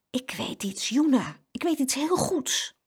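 high, excited discovery; light up as the idea lands; beaming with an idea. A narrator's reading. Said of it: "keek haar broertje stralend aan".

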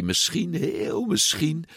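slow, high, hesitant; tentative hope. A narrator's reading. Narrator shares the boy's hope of winning, slow and tentative.

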